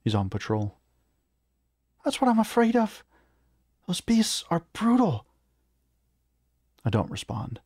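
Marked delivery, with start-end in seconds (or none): 2.0-5.3 very high-pitched worried character voice; high, anxious little voice; fearful companion. A narrator's reading. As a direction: voice a small, frightened companion way up high. Clara fearful of the orc.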